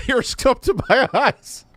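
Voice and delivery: high, hammed-up weepy voice; mock tearful. Mocking a man who cried in an interview, fake tearful. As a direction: pretend to choke up, pitch jumping high.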